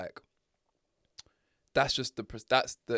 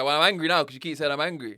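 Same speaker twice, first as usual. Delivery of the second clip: loud, higher, fast angry retort; angry shout; 6 semitones higher, 13 dB louder, faster than usual. Retold or imitated. Fed up with partner's accusations, shouting fast. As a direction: blast it out, fed up.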